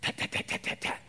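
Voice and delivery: soft, toneless tapping noise; quiet mouth-made door knock; imitated knocking. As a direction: tap out knocks with the tongue.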